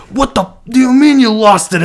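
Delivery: loud, high alarmed shout; papa shouting. Retold or imitated. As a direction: shout it as a worried grandfather, incredulous. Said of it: "like, shouting, dude. He's all concerned".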